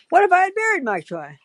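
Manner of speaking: "What" jumps high and fast; "What if" shot up high, quickly; high insistent question. Retold or imitated. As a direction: press the question at a high pitch. Repeats the question, emphatic.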